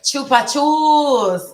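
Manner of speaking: Chupa chups said high, loud, slowly, falling; drawn-out Spanish word. Retold or imitated. Host recalling her Spanish teacher's candy, savouring it.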